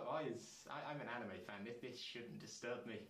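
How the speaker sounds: ordinary delivery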